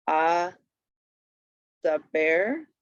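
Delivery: "a", then "the bear", spaced as a-da-ber; syllable by syllable. Shown as a demonstration. Teacher reading an accent example, "a the bear" broken up. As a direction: sound out "a the bear" with gaps, rising last. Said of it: "read the accent".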